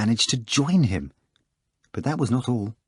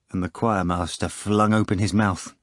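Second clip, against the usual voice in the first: normal talking voice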